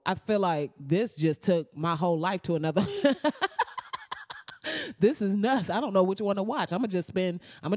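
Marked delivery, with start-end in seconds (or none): 2.9-4.9 squealing burst of laughter; a giddy high-pitched laugh; high delighted laugh. Real, not acted. Laughing with glee about the movie.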